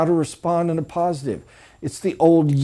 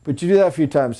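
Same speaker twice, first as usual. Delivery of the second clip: ordinary talk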